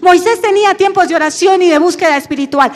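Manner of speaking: preaching at full force. Acted. Pastor to the church about Moses, preaching hard. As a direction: build the Moses story like a rising sermon.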